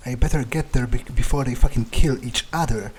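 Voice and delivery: usual speaking voice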